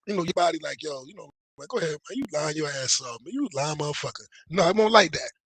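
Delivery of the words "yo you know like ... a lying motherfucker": much softer, playful jab; soft joking way. Retold or imitated. How Kelsey did not say it, softly teasing. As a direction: say it lightly, almost teasing. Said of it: "he wasn't saying it like".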